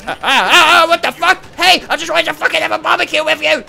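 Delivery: loud panicked yell. Real, not acted. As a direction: scream it in panic. Chased by monster.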